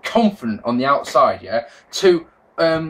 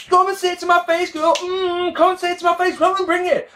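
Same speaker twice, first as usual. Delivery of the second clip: put-on much higher, sassy girl voice; mocking Bella taunt. Mocking the Bellas taunting AJ, high and sassy. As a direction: taunt like a catty reality star. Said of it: "sat there going".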